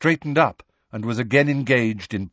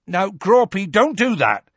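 higher, louder scolding call; shouted; 9 semitones higher, 5 dB louder than usual. A narrator's reading. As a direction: shout up a warning to a giant. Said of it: "shouted Hagrid".

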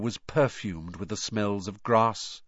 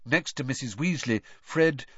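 usual speaking voice

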